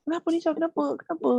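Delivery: high anxious asking; worried grandmother. Retold or imitated. Grandmother asks what happened, high and worried. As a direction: ask what's wrong, high and fretful. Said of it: "Arwah nenek aku".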